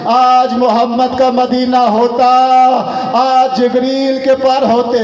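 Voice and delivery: slow, stretched cry of mourning; preacher's slow, plaintive wail in words; drawn-out lament. Acted. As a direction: draw each phrase out as a grieving call. Severed head's lament, voiced by preacher.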